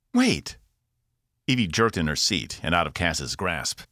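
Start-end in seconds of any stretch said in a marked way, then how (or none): 0.1-0.6 high urgent burst; sudden cry. A narrator's reading. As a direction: cut in sharply. Evie bursts out of trance.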